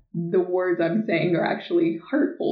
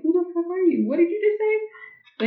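outraged retort; 10 semitones higher than usual. Retold or imitated. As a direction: fire it back at him in disbelief, way up high. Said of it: "I'm like".

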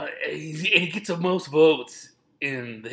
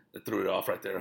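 ordinary delivery

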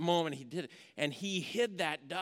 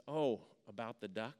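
soft, low, tender mother, much quieter; gentle mother; 6 semitones lower, 6 dB quieter than usual. Retold or imitated. Mother gently forgiving her tearful son, soft and tender. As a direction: ease the boy's guilt with a hush.

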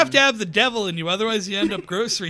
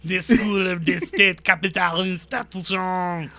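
put-on slow, lightly accented French lecturer; hint of a pompous French philosopher, slowly; mock French Foucault; slower than usual. Mocking how Foucault reads, a slow light French accent. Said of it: "read, like, all of Foucault and be like".

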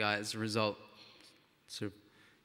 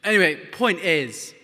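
ordinary delivery

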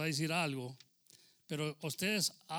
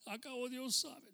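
higher, drawn-out mock tempting voice; voz engañosa; 9 semitones higher than usual. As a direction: put on the tempter's excuse, high and slow. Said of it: "esa voz que te está diciendo". (voz tentadora, aguda y arrastrada)

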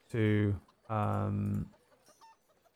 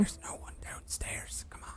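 unvoiced whisper from below; 14 dB quieter than usual. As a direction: whisper up urgently.

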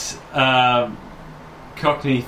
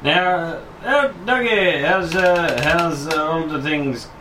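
a slipping Texan drawl, higher; Texan accent; 6 semitones higher, slower than usual. Acted. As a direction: try a Texan accent that keeps wobbling. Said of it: "My accent has gone really weird".

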